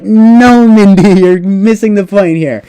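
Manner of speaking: lightly voiced smug husband; mock dismissive husband. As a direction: play the husband waving her off. Voicing the husband dismissing Mindy.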